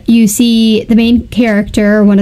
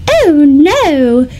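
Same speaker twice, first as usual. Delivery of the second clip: put-on feminine voice, pitched up; feminine dragon; 4 semitones higher than usual. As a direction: imitate a very feminine, dainty dragon. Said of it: "has a very feminine".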